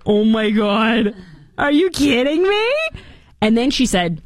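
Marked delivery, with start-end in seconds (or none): 0.1-2.7 drawn-out, high astonished gasp; mock disbelief. Host imagines being told she might be president. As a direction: play up giddy shock. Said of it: "That's what I would say".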